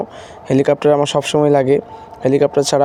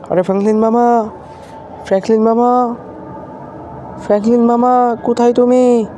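higher, louder call across the rooftop; 6 semitones higher than usual. Acted. Shinchan shouting down for his missing uncle, loud call. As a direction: yell for Franklin mama from afar. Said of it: "তুমি চিল্লাচ্ছ কেন?".

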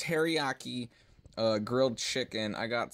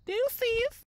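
squeaky high goodbye; high sign-off; 4 dB louder than usual. Real, not acted. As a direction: toss off a high-pitched farewell. Sign-off to viewers.

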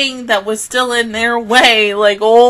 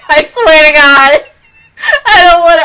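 higher, louder laughing cry; real laugh-sob; 7 semitones higher, 10 dB louder than usual. Real, not acted. Viewer laugh-crying about painful previews. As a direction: laugh through tears.

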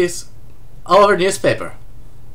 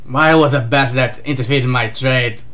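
low, lightly voiced angry warlord; a hint of a low, irritated villain; pissed-off Kaido; 6 semitones lower than usual. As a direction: grumble it low, a touch of annoyance. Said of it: "Says Kaido! Rather pissed".

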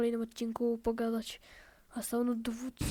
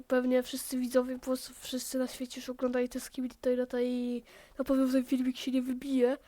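ordinary talk, nothing marked

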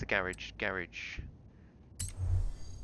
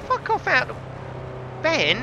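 high, squealed fuck off out; mock squeal; 12 semitones higher than usual. Streamer squealing at viewer Ben in jest. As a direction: shriek it in jest at a friend.

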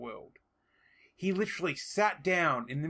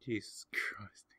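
whisper; 6 semitones lower, 7 dB quieter, slower than usual. Real, not acted. Reacting to Irwin hitting ground.

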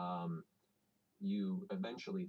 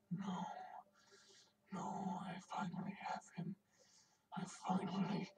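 sinister whisper; 12 semitones higher, 3 dB quieter than usual. Acted. Game master voices the shadow demon clinging to Percy, sinister whisper. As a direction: whisper it like a spirit clinging to its prey. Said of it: "you hear the voice whisper".

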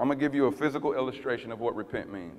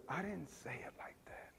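whispered, gentle correction; tender whisper; 6 semitones higher, 18 dB quieter than usual. Retold or imitated. The Lord showing the pastor a tender tone, whispering. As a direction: whisper it like a lover, not a judge. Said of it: "This is tender".

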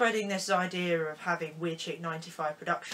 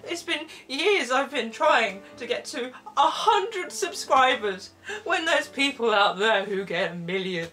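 mock-despairing plea, building louder; hammed-up sadness; 4 dB louder than usual. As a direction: plead pathetically, overdoing the misery. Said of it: "we'll go with sad".

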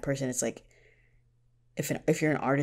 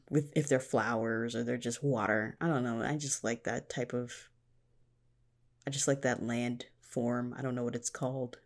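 ordinary talk, nothing marked

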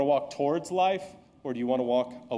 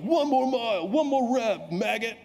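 high, loud taunting coach voice; mock drill sergeant; 9 semitones higher, 4 dB louder than usual. Coach taunting a tired kid, high and loud. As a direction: bark it like a drill instructor. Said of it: "it was like".

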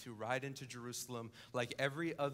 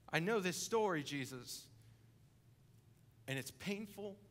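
higher, louder pained outcry; anguished cry; 5 semitones higher, 6 dB louder than usual. Pretend. Wronged hearer crying out to Jesus, anguished and louder. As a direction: plead with Jesus through old grief.

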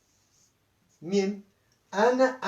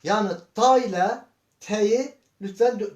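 usual speaking voice

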